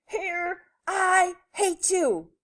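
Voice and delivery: angry high-pitched yell, drawn out; stretched-out, high, exasperated shout; mad yell. A narrator's reading. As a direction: yell it in a storybook temper. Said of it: "got so mad that he yelled".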